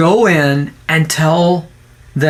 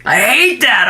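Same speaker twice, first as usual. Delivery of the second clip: normal talking voice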